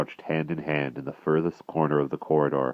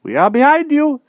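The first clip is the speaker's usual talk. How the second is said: anxious; 11 dB louder than usual. A narrator's reading. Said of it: "said Merlin anxiously".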